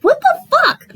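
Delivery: very high incredulous outburst; outraged disbelief. Retold or imitated. Viewer reacting to her being rejected, high-pitched outrage. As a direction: exclaim it way up high, appalled.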